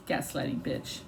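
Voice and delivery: low, voiceless under-breath jab; muttered aside. Real, not acted. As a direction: mutter it to yourself. Bitter aside.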